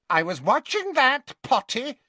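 a cheeky spirit complaining his show was spoiled; indignant Peeves. Acted. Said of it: "he told Harry indignantly".

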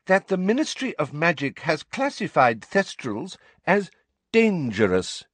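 high, prim, emphatic pronouncement; officious voice stressing every word; loud official warning. A narrator's reading. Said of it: "Umbridge said loudly".

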